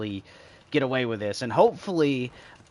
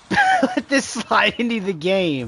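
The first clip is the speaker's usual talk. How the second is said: louder, higher, laughing through the words; real incredulous laugh; 6 semitones higher, 5 dB louder, slower than usual. Real, not acted. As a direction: burst out laughing mid-question. Laughs in disbelief at the game.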